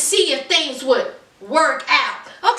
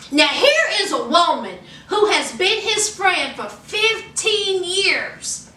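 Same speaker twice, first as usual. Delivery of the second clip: normal talking voice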